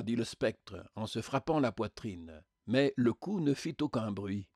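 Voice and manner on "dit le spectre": plain reading voice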